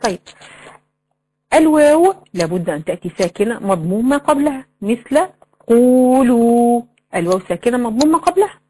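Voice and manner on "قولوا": قولوا with both oo vowels held long and level; drawn-out long vowel. Shown as a demonstration. Showing the waw madd to students, vowels lengthened. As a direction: stretch each waw in قولوا on one note. Example word with prolonged madd.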